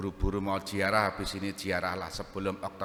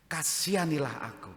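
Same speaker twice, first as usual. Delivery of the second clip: higher-pitched repeated plea; pleading; 7 semitones higher than usual. Acted. Blind Bartimaeus begging Jesus a second time, pleading. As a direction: beg for mercy, starting high and falling. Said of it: "dua kali dikatakan".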